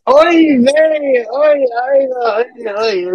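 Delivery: high, loud fretting oy vey; mock busybody. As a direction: ham up a kvetching old busybody. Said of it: "like a couple of busybodies".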